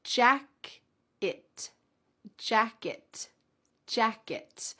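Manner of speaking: jacket said slowly with a clear final T; careful teacher demo of jacket; slow model word. Shown as a demonstration. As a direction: say jacket carefully, crisp T at the end. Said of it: "let's go over these words individually".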